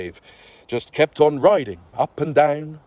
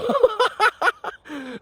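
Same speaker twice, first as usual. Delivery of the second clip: high cackling laugh that falls to a sigh; high old crone laugh; high witch laugh. Acted. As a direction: laugh at him with pity. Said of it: "The witch laughed and sighed".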